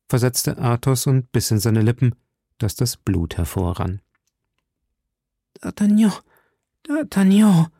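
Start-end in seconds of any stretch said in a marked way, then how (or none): 5.6-7.7 high, plaintive cry; dying woman crying out; desperate calling. A narrator's reading. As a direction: call out for your beloved, pitched very high. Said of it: "rief Madame Bonacieux".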